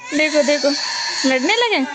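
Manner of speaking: loud, high excited call. Real, not acted. Kids start fighting.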